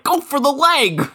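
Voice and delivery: high yelled corner-coach call; shouting from ringside; mock coach shout.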